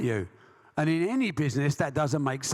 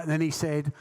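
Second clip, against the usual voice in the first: ordinary talk, nothing marked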